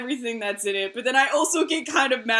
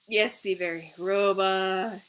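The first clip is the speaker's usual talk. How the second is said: normal talking voice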